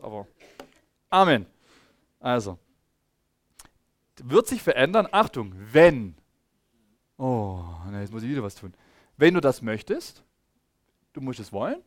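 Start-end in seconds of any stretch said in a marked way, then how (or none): none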